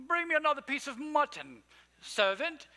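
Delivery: put-on high, loud British accent, lordly order; hammed-up British monarch calling a servant; British king. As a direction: demand it from the throne in a mock British accent. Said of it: "I don't know why they're British".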